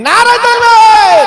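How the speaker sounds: very high, loud, stretched-out yell; full-force takbir shout. Shown as a demonstration. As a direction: scream the slogan for the crowd to answer. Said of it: "চিল্লে বলেন দেখি". (গলা ফাটিয়ে তাকবীরের চিৎকার)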